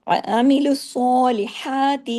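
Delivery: الصالحات with a heavy ص and a light لا and حا; heavy then light letters. Shown as a demonstration. Teacher contrasts heavy and light letters, slow. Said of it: "باریک ہو گیا".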